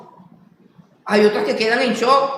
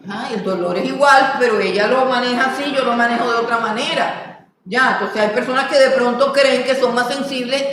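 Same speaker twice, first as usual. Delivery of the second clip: usual speaking voice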